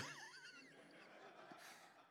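a very high-pitched laugh; gleeful soft high laugh. Real, not acted. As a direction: squeal with laughter. Laugh at the story.

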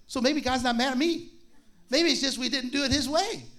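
high and loud, bursting with hope; relieved excitement, voice leaping up; David suddenly thrilled that God isn't mad; excited. Retold or imitated. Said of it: "David got all excited".

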